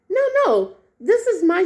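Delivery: high-pitched retold objection; alarmed protest.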